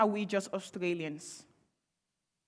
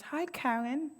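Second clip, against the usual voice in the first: higher, soft, sweet polite office chirp; whitened office voice; 6 semitones higher, 4 dB quieter than usual. Retold or imitated. Mocking how she must sound to coworkers, higher and sweetly polite. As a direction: put on a meek, pleasant voice for a coworker. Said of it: "speak like this".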